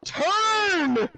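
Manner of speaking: high-pitched shouted tirade; real exasperated yelling; angry rant. Real, not acted. Raging at the game mid-play.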